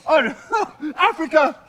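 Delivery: ordinary talk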